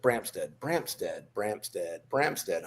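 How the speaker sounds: soft, low muttered chant of the name; quiet repeated name. Retold or imitated. Instructor murmuring a lagging recruit's name, quiet chant. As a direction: drone the name under your breath.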